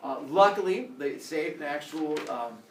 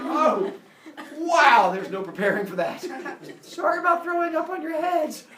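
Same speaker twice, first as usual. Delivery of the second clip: higher, louder grossed-out apology; recoil, then apologize awkwardly; disgusted Zeus; 11 semitones higher, 7 dB louder than usual. Acted. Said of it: "He's like".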